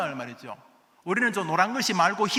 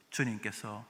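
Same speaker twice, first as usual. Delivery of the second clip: ordinary talk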